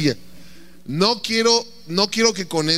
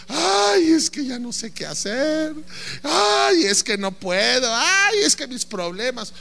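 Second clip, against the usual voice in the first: mock whining lament; 5 semitones higher, slower than usual. Mocking a despairing person.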